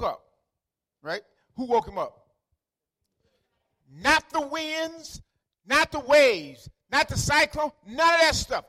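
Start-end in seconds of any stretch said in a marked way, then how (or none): 3.9-8.6 high, loud sermon climax; full-force preaching. Acted. Preacher saying only the disciples' call woke Jesus, full-force preaching. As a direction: drive each phrase out hard to the congregation.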